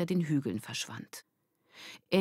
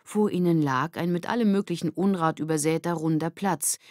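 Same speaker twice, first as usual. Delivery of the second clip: plain reading voice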